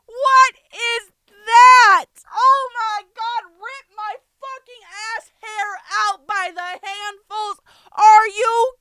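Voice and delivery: very high, loud outraged shrieking; drawn-out, exasperated yelling; aggressive screaming rant. Real, not acted. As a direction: scream it slowly in disbelief. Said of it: "I did sound very aggressive".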